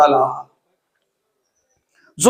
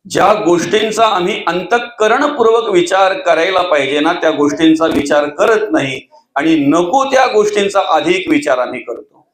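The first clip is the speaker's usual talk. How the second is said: ordinary talk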